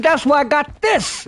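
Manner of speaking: slow smug reveal of the bankroll; gloating. Retold or imitated. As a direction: flash the money and rub it in.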